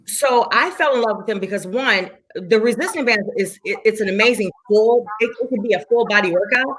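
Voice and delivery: ordinary delivery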